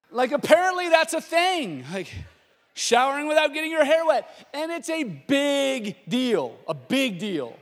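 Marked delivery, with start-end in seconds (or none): none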